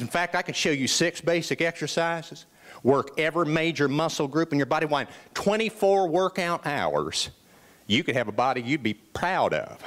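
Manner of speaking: imitated Southern trainer selling a workout; Southern gym buddy making his pitch; Southern accent. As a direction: put on a friend's Southern accent. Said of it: "he has this wonderful Southern accent".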